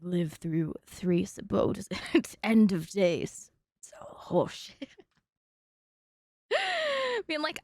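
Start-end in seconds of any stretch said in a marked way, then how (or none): 0.0-4.9 imitating his growly voice. Repeating the actor's clip in a low mock-growl. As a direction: ham up the actor's deep gravel. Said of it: "it's so growly".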